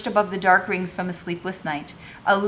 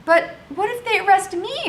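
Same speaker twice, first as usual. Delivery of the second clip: much higher, frightened question; voiced Madame fearful; 12 semitones higher than usual. A narrator's reading. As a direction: voice her panic at arrest. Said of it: "Madame said".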